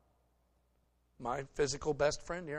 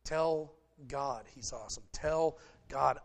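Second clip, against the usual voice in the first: ordinary talk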